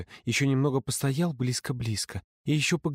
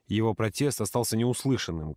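usual speaking voice

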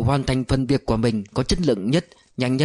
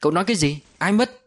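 startled demand; 5 semitones higher, faster than usual. A narrator's reading. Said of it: "Nhã giật giọng".

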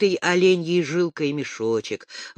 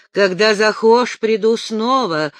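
ordinary delivery

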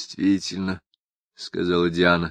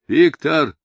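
hoarse, raised shout from the corridor; rasped wake-up call through a door; 9 semitones higher, 6 dB louder, slower than usual. A narrator's reading. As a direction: call out urgently in a hoarse, higher, louder voice. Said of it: "голос Тедди прохрипел из коридора".

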